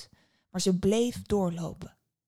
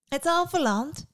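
put-on high, slow girl's echo; amused girl, rising in pitch; smiling question; 8 semitones higher, 5 dB louder, slower than usual. A narrator's reading. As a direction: repeat it with a smile, drawn out. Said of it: "vroeg het meisje glimlachend".

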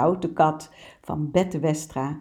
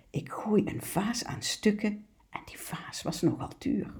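whispered, softer confession; guilty whisper; 3 semitones higher, 8 dB quieter than usual. Acted. Naughty cat admits breaking the expensive vase, sheepish whisper. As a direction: whisper it as if hoping nobody hears.

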